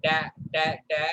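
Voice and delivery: ordinary talk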